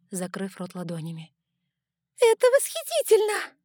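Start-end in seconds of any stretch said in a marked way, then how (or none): 2.2-3.6 high thrilled cry; excited shout. Acted. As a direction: shriek it with delight. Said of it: "крикнули какие-то девушки".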